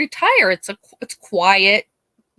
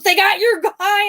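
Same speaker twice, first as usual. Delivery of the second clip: high and urgent; excited news; 13 semitones higher, 4 dB louder than usual. Retold or imitated. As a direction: blurt out the big news. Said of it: "he goes".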